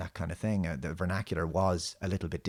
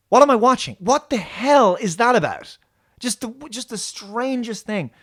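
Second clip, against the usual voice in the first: much higher, much louder disbelief; incredulous outburst; 13 semitones higher, 9 dB louder than usual. Real, not acted. Reviewer venting at the invisible android, much higher and louder. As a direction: throw up your hands at the screen. Ranting at nonsensical episode.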